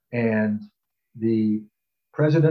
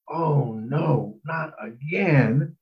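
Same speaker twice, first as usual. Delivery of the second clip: wary dread; 4 semitones higher, much slower than usual. Retold or imitated. Dreading another party invitation.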